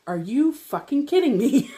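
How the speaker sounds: acting out fed-up disbelief, pitch swinging up; exasperated outburst. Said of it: "I'm crying and screaming at him".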